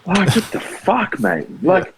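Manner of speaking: high, slow, incredulous what the fuck; exasperated disbelief. Real, not acted. Ranting to his co-host about team selection, high incredulous outrage. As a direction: pitch it up high and drag it out in outrage.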